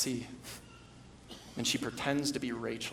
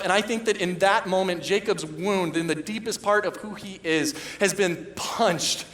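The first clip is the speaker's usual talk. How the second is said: higher and louder, driving sermon; impassioned preaching; 7 semitones higher, 8 dB louder than usual. Acted. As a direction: hammer each "can't" home from the pulpit. Preaching climax.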